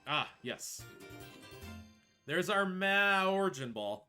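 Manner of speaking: usual speaking voice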